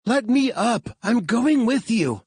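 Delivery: high, loud demand to be freed; frustrated outburst. Acted. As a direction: fight the straps with your voice. Frustrated Claire strapped down.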